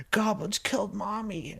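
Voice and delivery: put-on small, high child voice, kept soft; mock a frightened child in a high voice; upset little boy. Son telling dad about mommy, high mock-child voice.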